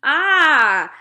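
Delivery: loud, sudden exclamation; giant's sudden cry. A narrator's reading. Giant startled by Sophie's question, loud cry. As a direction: blurt it out caught off guard. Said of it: "cried the B F G".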